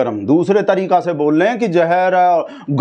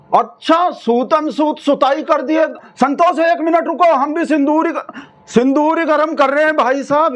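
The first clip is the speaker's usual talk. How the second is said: raised phone voice; 8 semitones higher than usual. Acted. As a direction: call out to Santosh on the line. Phone call with Santosh.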